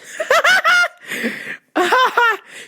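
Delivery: high-pitched burst of laughter; shrill laugh after the impression; high cackle. Real, not acted. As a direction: cackle at the joke.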